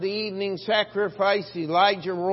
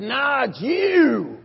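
loud, very slow comeback; a slow, loud "Nah" hurled at the king; defiant rebuke; 4 semitones higher, 7 dB louder, much slower than usual. Acted. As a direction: jab the finger back at the king.

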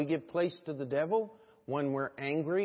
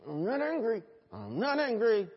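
higher-pitched insistence, covering up anger; mock denial; 6 semitones higher than usual. Preacher imitates people covering up their anger, insistent denial. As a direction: play an unconvincing denial. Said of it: "we try to cover it up".

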